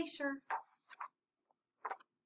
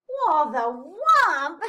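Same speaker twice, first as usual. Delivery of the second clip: loud, drawn-out mock disbelief; stretched, teasing question with little real giggle; mild giggly scoff; 4 semitones higher, 13 dB louder, slower than usual. A narrator's reading. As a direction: scoff playfully at the name. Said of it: "giggled Flip".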